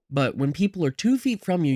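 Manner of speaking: normal talking voice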